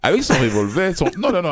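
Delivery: mock fear. Feint la peur de Harley.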